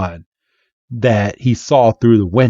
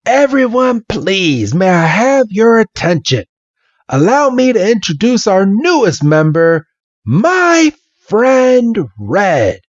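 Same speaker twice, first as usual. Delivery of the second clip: high, ceremonious announcement to a room; announcing old man; 10 semitones higher than usual. Retold or imitated. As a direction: introduce a guest to everyone, pitched high.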